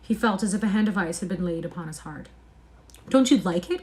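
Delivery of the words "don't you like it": raised, hurt question; cried out. A narrator's reading. Painter to the silent lad, crying out. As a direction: call out, stung by the silence. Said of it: "cried Hallward".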